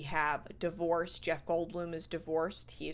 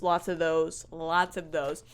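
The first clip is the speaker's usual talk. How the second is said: ordinary delivery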